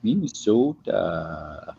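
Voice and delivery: drawn-out Minnesotan vowels, slow and low; Minnesotan, long slow o; exaggerated Minnesota accent. Teasing exaggerated Minnesotan pronunciation.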